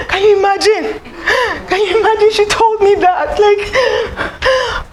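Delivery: high, tearful, breaking voice; retold weeping. Weeping to a new friend about the lost friendship, high and tearful. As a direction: plead through tears, pitch way up. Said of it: "I was weeping to the person".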